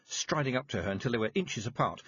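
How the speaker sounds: ordinary delivery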